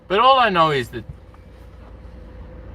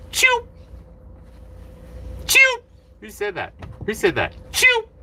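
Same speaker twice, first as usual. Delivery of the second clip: quoted catchphrase. Retold or imitated.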